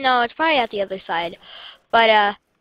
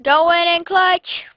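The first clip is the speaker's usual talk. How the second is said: excited shout; 7 semitones higher, 7 dB louder, slower than usual. Real, not acted. Hyping himself up mid-game.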